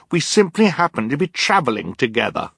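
put-on high-pitched girl's protest; insist, pitched well up; indignant cry. A narrator's reading. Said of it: "Violet cried".

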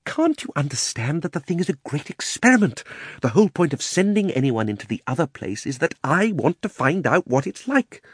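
high-pitched exasperated uncle; impatient Uncle Andrew. Acted. Uncle explains his great experiment to Diggory, impatient.

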